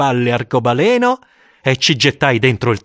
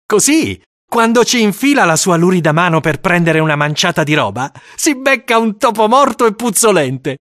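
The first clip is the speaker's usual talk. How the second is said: schoolboy voice; 8 semitones higher, 3 dB louder than usual. Acted.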